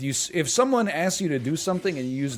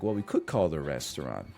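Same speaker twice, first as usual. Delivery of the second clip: a softer, much lower suggestion; 11 semitones lower, 6 dB quieter than usual. Retold or imitated. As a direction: gently float the idea to her. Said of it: "You could have been like".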